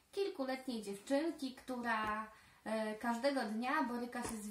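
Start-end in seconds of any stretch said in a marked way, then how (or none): none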